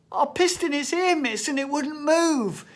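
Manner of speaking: high, loud lightly voiced schoolboy; little boy voice. Child explaining to his teacher, high innocent voice.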